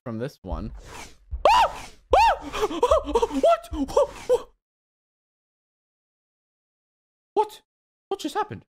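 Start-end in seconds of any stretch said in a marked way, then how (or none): 2.1-8.6 high stunned whats; shocked disbelief. Real, not acted. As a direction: cry out in surprise, high. Fangs trap surprise.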